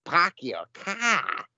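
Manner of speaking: put-on overdone Boston accent, slow and drawn out. English actor hamming a Boston accent, slow. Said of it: "They do it way too much".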